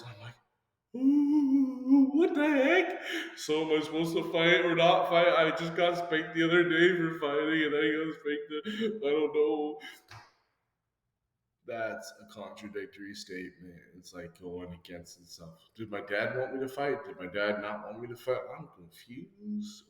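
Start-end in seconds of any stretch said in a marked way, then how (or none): 1.0-9.8 high, baffled what-the-heck; high mock bewilderment. Child baffled by dad's contradicting orders, high mock bewilderment. 11.7-19.8 quiet puzzled musing. Retold or imitated.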